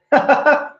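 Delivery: high put-on chuckle; quick high ha-ha burst; demonstrated laugh. Shown as a demonstration. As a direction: show them a high laugh. Demonstrates forced phone laughter.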